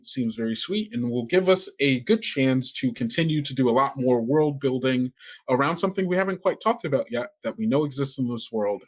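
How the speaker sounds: normal talking voice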